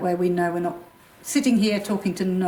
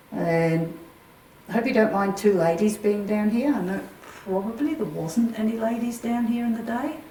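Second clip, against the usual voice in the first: addressing spirits; 4 dB louder than usual. Acted. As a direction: speak gently into the dark room. Calls out to spirits.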